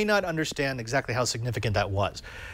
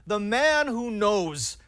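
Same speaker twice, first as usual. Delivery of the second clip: show announcer; 7 semitones higher than usual. Pretend. Host welcomes the Code Warrior to the show, announcer style. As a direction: stretch it out like a big guest introduction.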